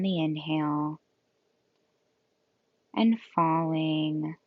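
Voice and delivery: calm yoga nidra guidance with long, restful pauses; guided meditation. Acted. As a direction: gently lead them into rest, leaving space between phrases.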